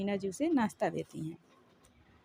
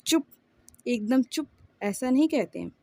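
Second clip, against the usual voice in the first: louder, mildly angry scolding; angry shouting; 3 semitones higher, 6 dB louder than usual. A narrator's reading. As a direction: hush the child with a restrained shout. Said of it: "गुस्से में आ गई और वो चिल्लाकर". (हल्के गुस्से में ज़ोर से डाँटते हुए)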